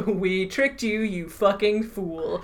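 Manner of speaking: slow, gloating villain line, lightly put on; good guy villain speech. Taunting Ahmed after the trick, lightly voiced villain speech. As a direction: draw it out like a hint of a villain. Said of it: "basically good guy villain speech".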